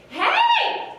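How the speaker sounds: very high, loud, screechy bird voice; screechy girl albatross calling out. A narrator's reading. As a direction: shriek it like an annoying seabird. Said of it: "the voice is high-pitched and screechy".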